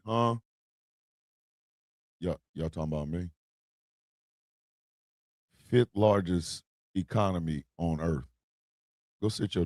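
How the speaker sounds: barely looking up, a low relaxed voice; chill California. Retold or imitated. California shrugs off the Texas talk, low and unbothered.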